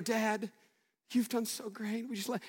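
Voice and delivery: soft, hushed mock praise for dad. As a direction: hum it softly as if fawning over dad. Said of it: "and pander".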